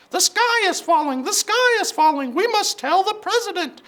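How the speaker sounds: very high, loud, frantic chicken voice; panicked clucking hen. Acted.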